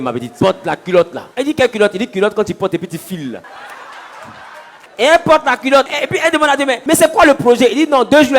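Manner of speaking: loud, high storytelling with voices; animated comic tale. Acted. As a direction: act the village story out for laughs. Comic retelling with voiced figures.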